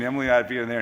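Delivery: normal talking voice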